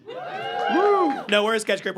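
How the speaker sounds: normal talking voice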